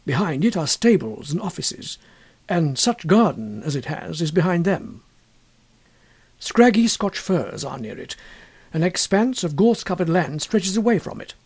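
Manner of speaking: ordinary delivery, nothing marked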